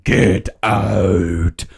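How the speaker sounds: low, drawn-out spooky warning; ghostly warning. Acted. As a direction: intone it like a haunted-house ghost. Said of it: "starts writing".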